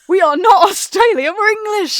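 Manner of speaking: loud, high, emphatic protest; hammed-up shout of national indignation; mock exasperated outburst. Host to someone who took them for Australian, mock exasperated shout. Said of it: "I really wanted to be like".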